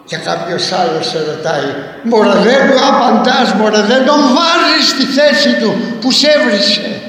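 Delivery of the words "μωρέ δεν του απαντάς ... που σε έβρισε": loud, high, goading voice; pushy bystander. Retold or imitated. Imitating a bystander urging retort to an insult, loud and goading. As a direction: egg him on to hit back.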